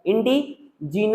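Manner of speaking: usual speaking voice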